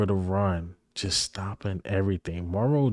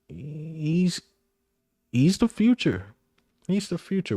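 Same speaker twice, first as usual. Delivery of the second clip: excited; 8 semitones higher than usual. Real, not acted. Hyping up young player.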